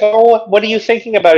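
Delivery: high, sing-song imitation of the girlfriend; high mock girlfriend voice. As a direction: ask it in a prying, high put-on voice. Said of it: "she's like".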